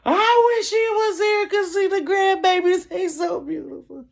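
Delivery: high weepy wail, fading at the end; mock-sob through the wish; crying. Wishing mama could see grandbabies, crying. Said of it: "we're sitting here crying".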